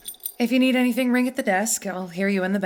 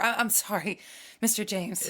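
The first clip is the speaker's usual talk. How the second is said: ordinary delivery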